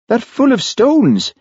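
put-on high, anxious young Scots voice; Charlie, nervous Highland constable. A narrator's reading. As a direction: sound spooked and uneasy.

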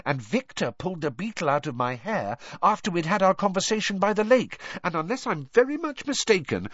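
put-on high, eager girl explaining her deductions; Hermione. Hermione listing where Rita spied, high and eager.

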